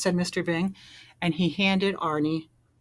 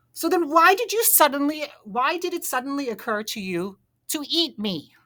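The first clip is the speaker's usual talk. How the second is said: demanding; 9 semitones higher, 5 dB louder than usual. A narrator's reading. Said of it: "Arnie demanded".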